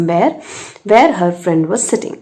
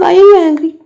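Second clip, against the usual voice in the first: higher, louder urgent asking; surprised question; 9 semitones higher, 7 dB louder, faster than usual. Retold or imitated. Teacher pressing the little girl about her anger, surprised and louder. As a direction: press the child in disbelief.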